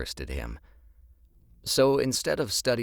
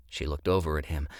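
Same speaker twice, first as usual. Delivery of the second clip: plain reading voice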